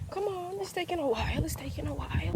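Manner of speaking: whispered come on; impatient whisper. Real, not acted. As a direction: mutter it under your breath impatiently. Impatient murmur waiting for watch.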